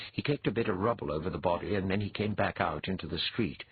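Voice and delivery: ordinary delivery, nothing marked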